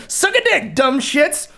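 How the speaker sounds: high, playful insult fresh from laughing; gleeful jab. Pretend. Repeating the jab at former friends, gleeful high insult.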